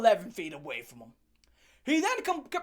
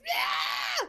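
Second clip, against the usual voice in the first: very high imitated pig squeal; high-pitched mock screaming squeal. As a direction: screech like a metal band singer. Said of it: "high-pitched, like, pig squeal".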